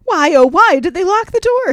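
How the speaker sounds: loud, high martyr wail. A narrator's reading. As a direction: whine it like a martyr. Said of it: "he wailed to himself in a martyr-like tone".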